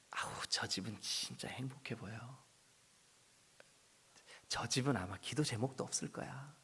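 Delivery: hushed envious whisper; quiet gossiping aside. Retold or imitated. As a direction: whisper it enviously about the neighbours. Imagined onlookers envying.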